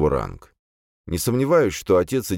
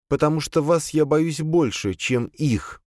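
high female servant voice; high-pitched, submissive confession; fearful servant demoness; 11 semitones higher, slower than usual. A narrator's reading. Servant admits to master whom she fears, high servant voice.

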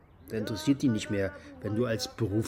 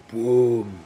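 mock explosion; 6 dB louder than usual. Former soldier recalling the bomb, mock explosion. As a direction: make the bomb go off with your mouth.

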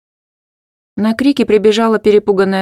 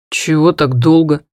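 slower, louder annoyed question; irritated; 5 dB louder than usual. Acted. Oscar annoyed at Hanna for keeping him waiting, louder. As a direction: grumble at her for being late. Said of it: "раздражённо поинтересовался Оскар".

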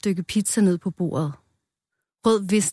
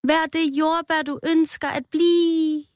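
very high call, long-drawn ending; mock shout; 10 semitones higher, slower than usual. A narrator's reading. Rød jumps onto the cake plate, calling out high. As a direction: cry out high and stretch the last word. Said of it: "Han sprang op på tallerkenen og råbte".

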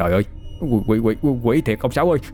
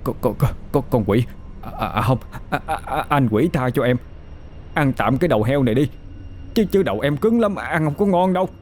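frightened begging; 4 semitones higher than usual. Acted. Boy begs the demon to spare him, stammering. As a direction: beg the demon in a trembling stammer.

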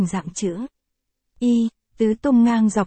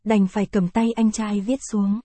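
plain reading voice

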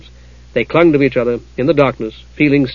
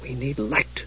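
muttered; 14 dB quieter, slower than usual. A narrator's reading. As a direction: mutter it under your breath. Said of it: "Usha muttered".

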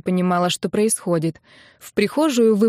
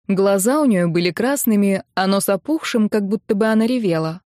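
usual speaking voice

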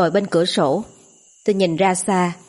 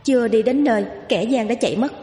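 mildly cross ghost woman; scolding; 3 semitones higher than usual. A narrator's reading. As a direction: tell off a noisy kid without raising the volume. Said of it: "Nhìn tôi quát mắng".

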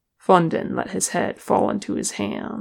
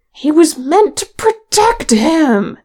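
loud, high angry shout; furious outburst at full voice; outraged; 10 semitones higher, 10 dB louder than usual. A narrator's reading. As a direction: yell it in indignant protest. Outraged dwarf protests amid shouting.

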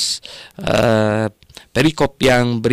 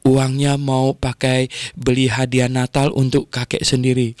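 normal talking voice

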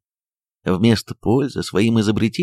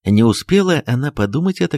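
plain reading voice

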